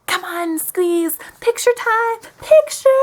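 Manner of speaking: high, pushy picture-time voice; imitating overeager date. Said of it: "he actually spoke like this".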